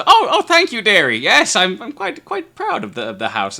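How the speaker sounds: put-on high, loud, fluttery old lady voice; a proud little old lady accepting praise; Esme flattered. Esme thanking the guest for praising her house, high old lady voice.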